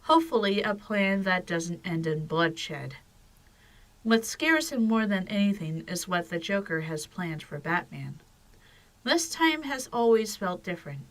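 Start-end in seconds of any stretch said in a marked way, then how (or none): none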